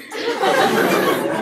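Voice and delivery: short breathy laugh. Real, not acted. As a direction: burst out laughing. Laughs at own embarrassing story.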